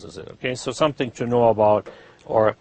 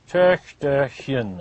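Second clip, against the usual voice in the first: "Töchterchen" very slowly, in three parts; slow syllables; much slower than usual. Shown as a demonstration. Teacher modelling the diminutive, slow syllables.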